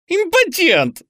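very high, loud screech; frenzied yell. A narrator's reading. Bound boy taunting his captor, frenzied yell. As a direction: scream the insult at full pitch. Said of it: "орал Лёня".

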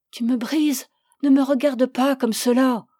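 effroi léger, voix un peu tendue; balbutiement effrayé. A narrator's reading. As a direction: supplier avec une pointe de peur. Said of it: "Effrayée, elle balbutia". (peur discrète de femme malmenée)

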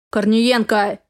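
barked name; barking. Acted. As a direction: bark the name like an officer. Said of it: "рявкает Малышкин".

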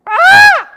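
piercing high shriek imitating a toddler; mock toddler howl at full force; toddler scream. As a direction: let out a little child's ear-splitting scream. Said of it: "Catherine Grace going".

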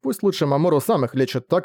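usual speaking voice

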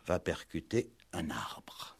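slow, whispered ending; slow it right down and breathe it out; hushed climax. Acted. Inspector revealing the crash, in a slow whisper.

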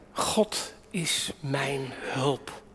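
hushed, very slow declaration of trust; soft slow confession. Acted. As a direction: let it sink in quietly, almost to yourself. Lazarus' words quoted softly.

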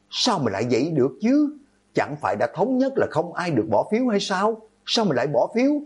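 high-pitched outraged questioning; crying out. A narrator's reading. Student demanding why someone voted, crying out. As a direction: cry out in disbelief, pitched high without much volume. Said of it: "Hạng Văn Thành gào lên".

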